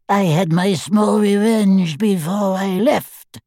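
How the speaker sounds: high, slow, proud goblin. A narrator's reading.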